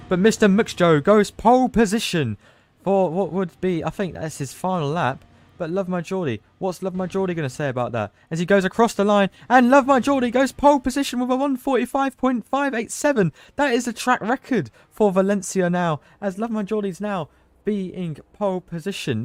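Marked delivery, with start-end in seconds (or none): 0.1-3.8 rising shout of excitement for pole; excited call. Real, not acted. Commentator calls pole position finish. 8.3-19.2 high, loud thrilled commentary call; excited shout. Real, not acted. Commentator calls track record lap.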